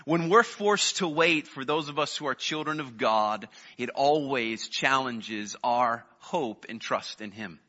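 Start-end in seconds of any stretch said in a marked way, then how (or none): none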